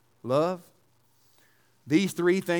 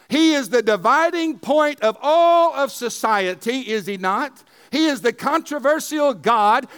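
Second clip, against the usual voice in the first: raised, driving preacher's voice; fiery preaching; 6 semitones higher, 5 dB louder than usual. Acted. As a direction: preach it out loud to the room. Preaching at full force.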